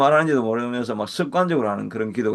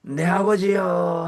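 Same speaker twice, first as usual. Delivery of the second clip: very slow, higher, drawn-out calling of Father; yearning prayer cry; 5 semitones higher, much slower than usual. Shown as a demonstration. Pastor showing congregation how to call on the Father, slow high yearning cry. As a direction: stretch each 아버지 out, reaching toward God. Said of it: "하고 부르는 기도가".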